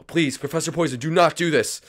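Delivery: a hint of a desperate sidekick; pleading sidekick. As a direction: plead with the villain. Sidekick begs the villain.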